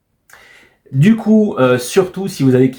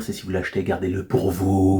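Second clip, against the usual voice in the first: voice from beyond the grave; 5 semitones lower, 7 dB quieter than usual. Pretend. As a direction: creep it out like a ghost. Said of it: "cette vieille voix d'outre-tombe".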